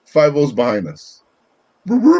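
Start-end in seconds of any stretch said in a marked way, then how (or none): 1.9-2.2 siren imitation.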